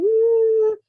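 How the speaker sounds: loud, high imitated bawl; imitated crying "aah"; mock crying wail. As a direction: wail like a crying kid, hammed up. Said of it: "and he's crying".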